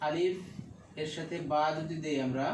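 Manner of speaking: normal talking voice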